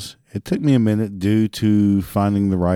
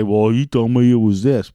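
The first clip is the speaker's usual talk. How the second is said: mocking the customer, louder; mock customer whine; 4 dB louder than usual. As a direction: whine it like a customer blaming you. Mocking customer complaining later.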